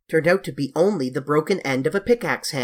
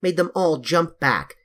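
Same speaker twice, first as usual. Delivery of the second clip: plain reading voice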